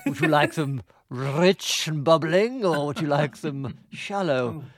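slow, theatrical connoisseur's delivery; hammed-up, drawn-out impression of a grand actor; Orson Welles. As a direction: savour each option with grand, ponderous relish. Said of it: "they got Orson Welles to do the farts".

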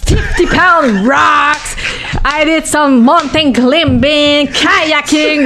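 put-on high, slow, drawn-out bragging in French-accented English; laying it on thick. Boxer retelling how she embellished training for journalists, laying it on thick. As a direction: stretch each feat out, hammed-up for effect. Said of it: "j'en ai mis".

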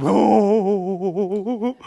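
long wavering ghostly "woooo"; mock spooky wail. Preacher mocking people who get spooky and weird, eerie howl. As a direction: wobble it out like a cartoon ghost. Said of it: "get all spooky and weird".

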